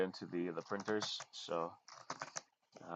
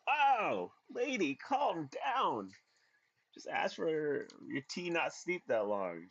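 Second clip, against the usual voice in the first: high, loud mock outrage, drawn out; incredulous retort, stretching the words way up high; indignant disbelief; 9 semitones higher, 6 dB louder, slower than usual. Acted indignant retort to rude customer.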